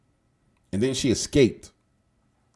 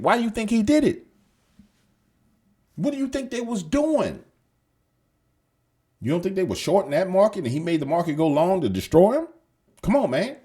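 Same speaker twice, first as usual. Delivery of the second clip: much higher, pressing rhetorical questions; rising exasperation; 9 semitones higher than usual. Real, not acted. To viewers, exasperated high-pitched rhetorical questions. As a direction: push them, incredulous and pitched up.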